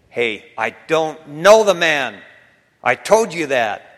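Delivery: loud, irritated acted denial; cursing denial. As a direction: act out Peter swearing he doesn't know him. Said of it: "he began to curse and swear".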